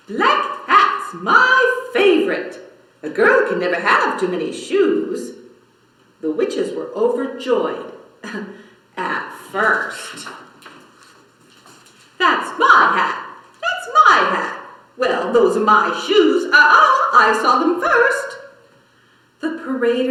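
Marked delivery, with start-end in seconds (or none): 0.1-5.3 high, louder thrilled squeal; delighted witch. Acted. Witch delighted at hats falling from the sky. 12.2-18.3 high, loud bickering shout with laughs; squabbling witch. Acted. Witches squabbling over hats and shoes, shouting.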